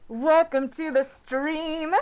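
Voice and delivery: slow, drawn-out stream welcome; announcer voice. Acted. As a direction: roll it out like a host opening the show. Opening the live stream.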